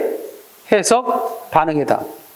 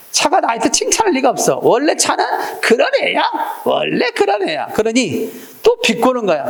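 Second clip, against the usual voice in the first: higher, lightly voiced resentful thought; teacher voicing Younghee's suspicious inner thought, higher; inner grumbling; 6 semitones higher than usual. A touch of sulky suspicion. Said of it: "자기 경험에 비추어서 생각한 거지".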